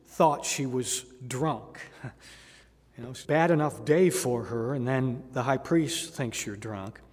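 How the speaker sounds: ordinary delivery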